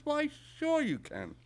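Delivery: put-on high, drawn-out old lady reply; little old lady. Old lady agreeing to a shopkeeper's questions, high slow voice. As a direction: stretch it out high and obliging. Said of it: "a little old lady".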